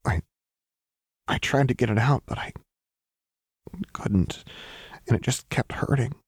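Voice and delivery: hollow and broken up by pauses. A narrator's reading. Jinx explaining her scratches to Lux, hollow and halting. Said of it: "Jinx said hullily".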